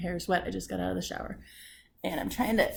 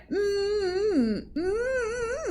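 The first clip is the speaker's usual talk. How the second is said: high, long strained "mm"; frustrated hum; 9 semitones higher than usual. Pretend. Woman imagining being that man's wife, exasperated. Noises threatening the man as wife.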